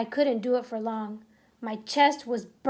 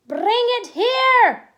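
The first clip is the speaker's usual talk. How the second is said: very high, loud, slow shout; shouted command; 10 semitones higher, 10 dB louder, slower than usual. Acted. Grand High Witch demanding the boy, slow loud shout. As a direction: stretch out the command. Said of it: "shouted the Grand High Witch".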